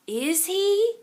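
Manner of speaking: ordinary delivery, nothing marked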